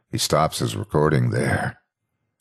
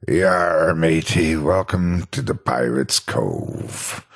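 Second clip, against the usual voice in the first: slow, drawn-out pirate greeting; slower than usual. Acted. Animatronic fox greeting the narrator, slow pirate voice. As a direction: greet like an old robotic pirate, slowly. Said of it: "he says slowly".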